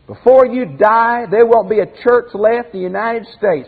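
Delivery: preacher playing a prophet of ruin; pretend prophet's warning. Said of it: "it'd be like me coming in here some night and saying".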